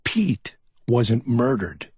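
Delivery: slow, short and firm; curt declaration. A narrator's reading. McMain corrects Chuck, curt. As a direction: cut him off flatly. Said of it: "McMain declared shortly".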